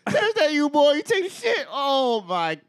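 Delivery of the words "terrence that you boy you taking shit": high, loud taunting shout; mocking bully. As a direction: call out teasing from the hallway. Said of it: "It was like".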